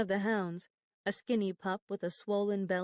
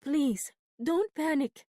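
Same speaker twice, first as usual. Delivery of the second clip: higher, loud urgent plea; urgent pleading; 7 semitones higher, 5 dB louder, slower than usual. A narrator's reading. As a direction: beg her to calm down. Nella pleads during struggle.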